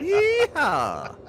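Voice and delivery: high, drawn-out country whoop; hammed-up country holler; country yeehaw. Showing off how country she is, high country whoop. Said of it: "about as country as you can get".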